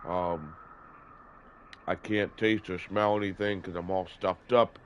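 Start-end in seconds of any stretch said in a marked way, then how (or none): none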